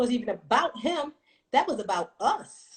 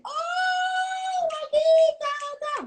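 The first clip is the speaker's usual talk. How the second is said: squealing much higher with joy at a reunion; excited screaming; much slower than usual. Retold or imitated. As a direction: shriek with delight, very high. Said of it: "We hugged and we screamed".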